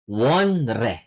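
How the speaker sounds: "Wonre" low, with separate n and r; spelling pronunciation. Shown as a demonstration. Korean teacher reading out the spelled word, spelling pronunciation. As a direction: sound out "Wonre" as written. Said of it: "pronunciation is not wonre".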